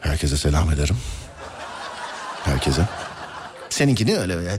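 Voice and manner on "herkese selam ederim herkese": airline pilot announcement. Shown as a demonstration. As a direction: lower captain voice signing off. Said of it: "Pilotlar öyle girer ya mesela".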